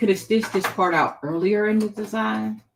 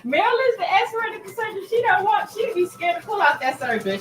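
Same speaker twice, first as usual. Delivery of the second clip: excited protest; 11 semitones higher, 6 dB louder than usual. Real, not acted. Reacting to chat, exclaiming.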